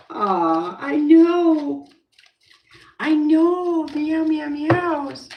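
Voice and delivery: high, loud cooing and meowing. Real, not acted. Cooing to her cat, high meowing baby talk.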